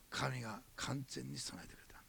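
slow reverent whisper; whispered reverence; awed whisper. Real, not acted. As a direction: hush it like a holy secret. Testimony of God's provision.

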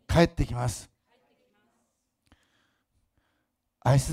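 slow, measured proclamation with long pauses; solemn prophecy over the city; prophetic declaration. Acted. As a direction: declare each phrase as a promise.

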